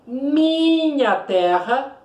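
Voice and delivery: minha drawn out slowly and lifted; stress on minha. Shown as a demonstration. Teacher stressing minha to show the poetic eu. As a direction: stretch out minha to mark the poet's own voice. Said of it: "ou seja".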